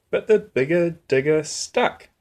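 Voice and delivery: ordinary delivery, nothing marked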